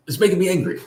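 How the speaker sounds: lightly voiced older woman, assertive but not angry. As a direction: state the grievance firmly, without rage. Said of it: "it was an assertive tone".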